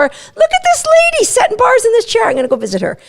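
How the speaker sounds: high, excited dog voice; distracted dog. Dog spots the lady setting bars, high and excited. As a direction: squeal it like a dog spotting a new friend.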